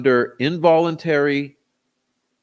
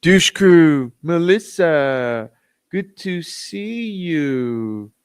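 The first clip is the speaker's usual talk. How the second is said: very slow, drawn-out mock greeting, lightly voiced; mocking impression; 6 semitones higher, much slower than usual. Rival greeting a viewer after the bong hit, in a lightly voiced, slow, drawn-out mock. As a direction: stretch the greeting out lazily in the mocked voice. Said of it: "I'm not necessarily an impressionist".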